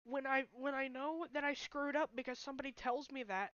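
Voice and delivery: fighting back tears; tearful. Real, not acted. To viewers about friends' blame, near crying. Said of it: "of course I'm gonna cry".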